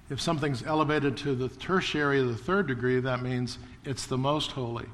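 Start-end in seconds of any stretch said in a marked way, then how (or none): none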